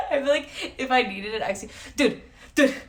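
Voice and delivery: cracking up mid-sentence; laughing. Real, not acted. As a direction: laugh through the words. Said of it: "laughing fit".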